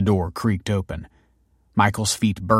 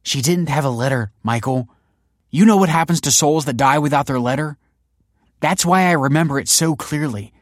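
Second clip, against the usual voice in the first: high, raised-voice argument; heated insistence; 7 semitones higher, 6 dB louder than usual. A narrator's reading. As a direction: press the point, upset and certain.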